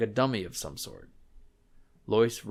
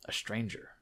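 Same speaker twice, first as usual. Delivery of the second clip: hushed, breathy whisper; a chill whisper over the dead man; 7 dB quieter than usual. A narrator's reading. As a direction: let it hang in a whisper, unsettling. Eerie narration of the corpse.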